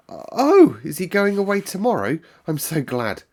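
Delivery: high, loud burst of relief; a girl suddenly overjoyed; relieved delight. A narrator's reading. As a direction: leap up with glad relief. Mary relieved at news.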